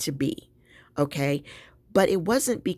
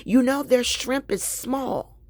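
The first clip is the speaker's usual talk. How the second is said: higher, whiny complaint; mock his griping about the shrimp; mimicking Gary's complaint; 8 semitones higher than usual. Wife mimics husband griping about small shrimp, higher and whiny. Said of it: "he was like".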